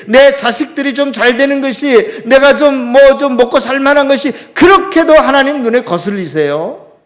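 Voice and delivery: loud, high, indignant protest to God. Acted. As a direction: build an aggrieved challenge, phrase by emphatic phrase. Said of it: "요나가 대드는 거하고 비슷해요". (하나님께 대들듯 크게 원망하며)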